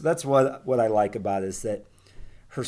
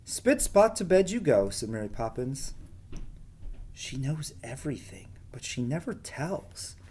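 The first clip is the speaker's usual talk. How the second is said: gentle storybook reading voice; reading to children. Acted. Teacher reading a storybook aloud to her pupils, gentle. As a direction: read it like a bedtime story to the class.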